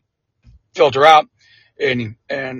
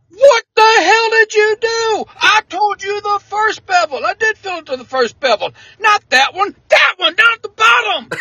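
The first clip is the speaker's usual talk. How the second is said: angry yell. Retold or imitated. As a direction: yell at the recruit in disbelief. Angry commander scolding about coffee.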